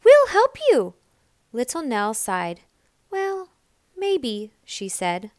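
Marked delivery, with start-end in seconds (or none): 0.0-0.9 much higher, louder happy cub; eager offer. A narrator's reading. The animals promise to help Nell. 3.1-4.5 higher, unsure small voice; hesitant Nell. A narrator's reading. Little Nell hesitantly agrees to the animals.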